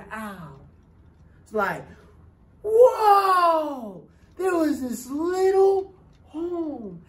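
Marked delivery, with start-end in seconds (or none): 2.6-4.0 high, loud astonished whoa; shocked whoa. Retold or imitated. Hudson reacts to the crowded home with a loud shocked whoa. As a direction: gasp it out in shock.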